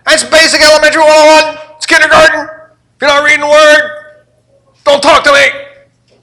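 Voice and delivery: high, loud preacher's cry, slowing on the last words; full-force preaching. Acted. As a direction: hammer it at the congregation.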